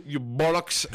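slow laughing mock British insult; mock British accent. Mocking British listeners with a laughing British-accent insult. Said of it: "insult you in a British accent".

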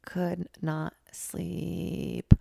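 slow, weary repeat of "Could not sleep"; drawn-out emphasis. Real, not acted. Repeating to listeners how bad the night was, very slow. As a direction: drag out each word of "Could not sleep".

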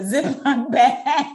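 laughing. Real, not acted. Reading funny post, laughing.

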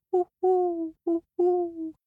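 high hooting owl; owl hoots. A narrator's reading. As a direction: hoot like an owl. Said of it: "He heard owls hoot".

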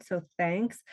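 normal talking voice

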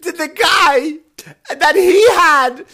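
very high, slow, loud gushing voice; hammed-up woman shouting out in a comedy show; loud chatty woman. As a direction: ham up an oblivious woman agreeing with a joke. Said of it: "I'm trying to do my bit".

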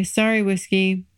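normal talking voice

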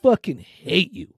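retold angry outburst. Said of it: "I go".

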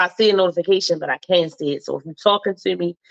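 normal talking voice

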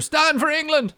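high, loud patriotic shout; hammed-up jingoistic shout; mock rallying cry. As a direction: rouse the troops with a hammed-up cry.

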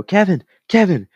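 high, fast put-on interviewer voice; reporter voice. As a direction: chirp it like an eager sideline reporter. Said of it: "They go".

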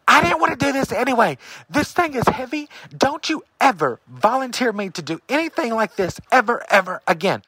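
high-pitched retort in a hushed argument; acted-out indignant complaint; mock whisper-yelling. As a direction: argue back in a strained, high stage yell. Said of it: "So I'm yelling across to him".

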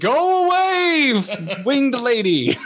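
high, drawn-out mock wail; hammed-up high-pitched exasperation; exasperated retort. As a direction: whine at a pest to leave. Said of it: "I'm just like".

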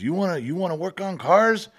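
the Mexican shop boss sizing up the kid, mock Mexican accent; imitating boss's Mexican accent. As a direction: lightly put on the old boss's Mexican accent, teasing. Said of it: "He had a thick, thick Mexican accent".

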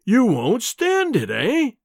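slow, high sneering challenge. Acted. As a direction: taunt him slowly and loudly. Professor sneers at the narrator.

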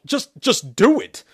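loud, high, exasperated order; insistent. Acted. Priest insists that Shepard chant, exasperated.

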